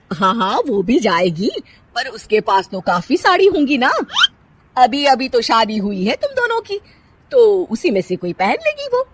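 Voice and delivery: mother-in-law waving the question away; dismissive mother-in-law. Acted. Usha brushes off son. (लापरवाह सास की आवाज़)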